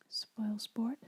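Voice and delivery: usual speaking voice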